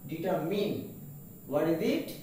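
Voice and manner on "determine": Determine ending in "min"; correct pronunciation. Shown as a demonstration. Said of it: "The word is determine".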